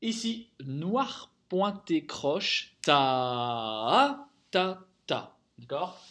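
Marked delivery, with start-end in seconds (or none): 2.8-5.4 long "ta" re-accented on the second beat, then "ta, ta"; second-beat stress. Shown as a demonstration. Teacher demonstrates dotted crotchet to pupils, second-beat stress. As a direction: hold the first "ta", push its second pulse upward, then two short "ta". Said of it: "On insiste bien sur le deuxième temps".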